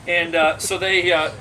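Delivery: ordinary talk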